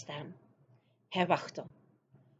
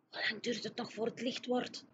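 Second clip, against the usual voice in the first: put-on much softer, hushed girl voice; whispering; 4 semitones higher, 8 dB quieter than usual. Sophie asking the giant how long till dawn, hushed. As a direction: ask quietly, eyes on the dark windows. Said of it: "fluisterde Sophie".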